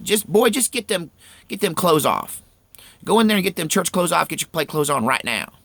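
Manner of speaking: put-on a mama ordering her boy to change; mother scolding. Mother ordering her son out of church clothes, scolding. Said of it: "she's like".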